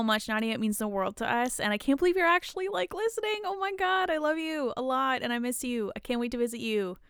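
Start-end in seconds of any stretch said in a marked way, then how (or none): 1.6-4.7 high, fast excited gush. Real, not acted. Host gushing to a friend listening. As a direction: gush with disbelief.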